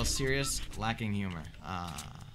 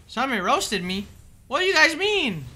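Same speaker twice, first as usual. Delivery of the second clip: mock disbelief; 8 semitones higher, 6 dB louder than usual. Mock disbelief at chat claim.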